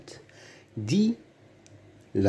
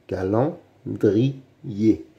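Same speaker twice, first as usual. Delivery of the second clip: "calendrier" very slowly, split by pauses; syllable by syllable; much slower than usual. Shown as a demonstration. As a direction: sound out "calendrier" beat by beat. Teacher splits word into syllables. (« calendrier » très lentement, syllabe par syllabe)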